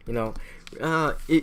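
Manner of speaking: ordinary delivery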